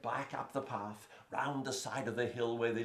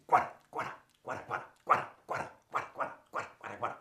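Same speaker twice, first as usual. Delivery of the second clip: breathy unvoiced guara guara, fading; rabbit hopping sound; much faster than usual. Shown as a demonstration. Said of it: "the sound he makes when he moves is this".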